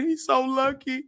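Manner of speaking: high, tearful wail of envy; crying. Retold or imitated. To Theo in the car, crying high and tearful. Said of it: "I'm just crying".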